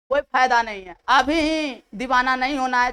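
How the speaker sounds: normal talking voice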